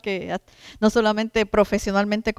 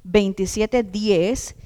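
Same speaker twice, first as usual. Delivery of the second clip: fervent preaching; 4 semitones higher, 5 dB louder than usual. Acted. Preacher rousing the congregation, emphatic sermon. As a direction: preach it out to the flock.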